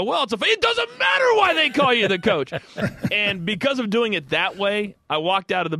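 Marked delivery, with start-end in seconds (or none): 0.0-2.5 high, loud laughing shout of the catchphrase; mock wrestling-promo yell, cracking up. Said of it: "And I go".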